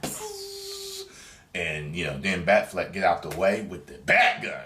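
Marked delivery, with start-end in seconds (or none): 0.0-1.1 high hissing psst for laser eyes; mock zap sound. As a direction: make the eye-beam noise. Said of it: "he's like".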